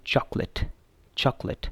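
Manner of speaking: चॉकलेट said crisply in two syllables as chak-let; correct pronunciation. Shown as a demonstration. Teacher models the correct चॉकलेट for students, clear and careful. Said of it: "सही pronunciation है वो है".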